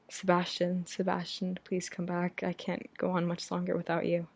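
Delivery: usual speaking voice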